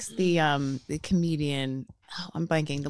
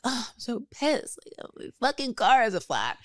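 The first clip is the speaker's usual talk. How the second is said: melodramatic complaint; 6 semitones higher than usual. Retold or imitated. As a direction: retell it as an overdone gripe. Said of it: "more drama".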